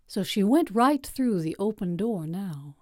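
ordinary delivery

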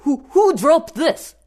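loud, high startled cry; startled shout. A narrator's reading. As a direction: shout it out, shaken. Startled after near miss.

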